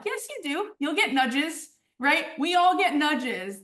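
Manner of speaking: high, insistent and slow on we all get nudges; emphatic retort. Retold or imitated. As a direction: press it home that everyone gets nudges. Insisting to doubters, own retort.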